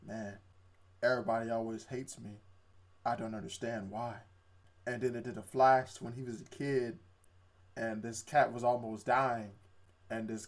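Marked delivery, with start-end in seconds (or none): 0.1-4.2 low, soft, downcast retelling; mock dejected. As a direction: lightly voice a glum complaint. Voices hated main character.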